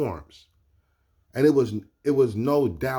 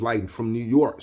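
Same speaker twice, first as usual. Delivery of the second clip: ordinary talk, nothing marked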